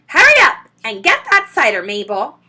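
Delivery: loud high call from upstairs. A narrator's reading. Mrs Bean calls down to Mabel, loud and impatient. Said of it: "the voice called".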